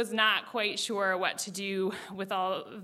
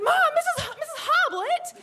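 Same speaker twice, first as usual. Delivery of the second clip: much higher, louder laughing wail; shocked protest; 6 dB louder than usual. Retold or imitated. Daughter protesting her mom's detention, high laughing wail. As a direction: protest in disbelief at the punishment. Outraged protest at mom teacher.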